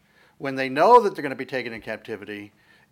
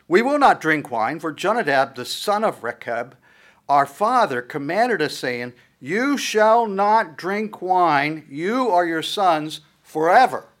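louder, slow, higher, each word weighted; solemn emphatic vow; 4 semitones higher, 6 dB louder, slower than usual. Acted. Rechabites telling Jeremiah their father's command, solemn. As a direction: declare the vow as unbreakable law.

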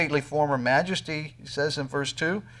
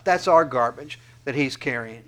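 ordinary talk, nothing marked

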